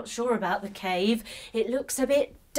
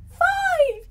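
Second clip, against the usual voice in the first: excited; 7 dB louder than usual. Acted. Counting climax.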